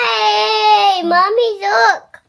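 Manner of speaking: tearful shout for mommy; scared cry, calling loud. Real, not acted. Scared toddler calls for mom.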